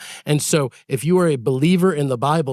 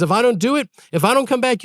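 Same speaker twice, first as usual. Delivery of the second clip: eager pleading; 7 semitones higher than usual. Retold or imitated. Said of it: "He says".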